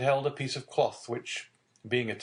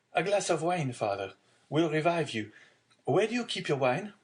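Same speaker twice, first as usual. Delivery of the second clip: put-on higher-pitched, solicitous young man; concerned young son; 7 semitones higher than usual. Son seeking wine to revive his father, concerned. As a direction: offer help in a raised, questioning pitch.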